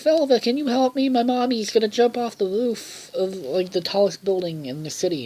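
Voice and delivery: put-on high-pitched child begging for help; Beth, pleading. Child begs Velva for help.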